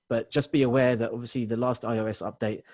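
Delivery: ordinary delivery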